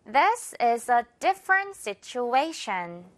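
This is a different situation, slow, A in situation stressed; slow model sentence. Shown as a demonstration. Teacher modelling the sentence for repetition, slow. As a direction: read it slowly with the stress. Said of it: "한 번 더요".

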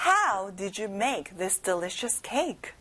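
slow, careful read-along; slow model for repeat. Shown as a demonstration. Teacher repeats the sentence slowly for the kids' turn. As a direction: say it slowly for them to echo. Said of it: "Now it's your turn".

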